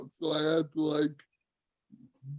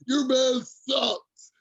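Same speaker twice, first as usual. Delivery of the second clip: higher, drawn-out Dublin taunt; imitated Irish heckler; 5 semitones higher, slower than usual. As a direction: taunt slowly in a mock Irish accent. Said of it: "harassing me on Facebook Messenger from Dublin".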